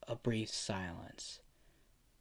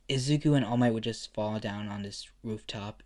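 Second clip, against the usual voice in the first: plain reading voice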